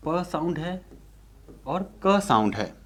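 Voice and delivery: ordinary delivery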